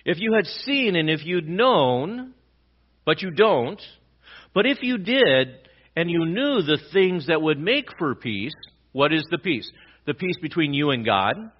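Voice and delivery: a preacher pleading with his flock; fervent preaching. Acted. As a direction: preach it with rising fervour. Preaching at full force.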